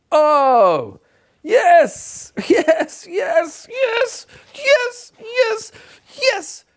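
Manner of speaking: high, squealing yeses with a laugh; excited yes. Pretend. Pupil who checked himself, squealing excited yeses. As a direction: squeal with glee at being right. Child delighted at getting it right.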